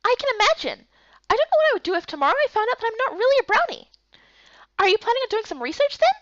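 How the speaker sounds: high, quick chirpy voice; bubbly little companion; perky girl character. Acted.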